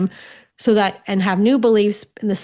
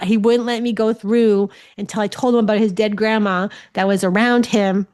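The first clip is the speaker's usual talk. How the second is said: normal talking voice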